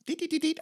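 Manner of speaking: high mock dialing beeps; phone beeps. High dialing beeps while calling a gallery. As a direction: chirp out the phone keypad. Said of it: "right away you're like".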